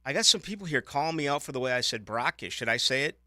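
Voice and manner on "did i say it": normal talking voice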